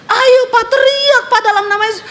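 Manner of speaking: high-pitched urgent call; urgent pleading. Retold or imitated. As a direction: urge a husband to cry out, pitched right up. Wife urges husband to shout. (seruan mendesak istri, nada sangat tinggi)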